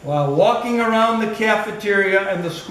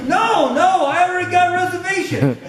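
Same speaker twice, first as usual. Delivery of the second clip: indignant Ruff; 10 semitones higher than usual. Retold or imitated. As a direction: mimic the friend shouting back in indignation. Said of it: "I said".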